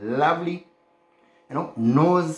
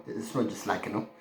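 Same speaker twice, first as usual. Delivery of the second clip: usual speaking voice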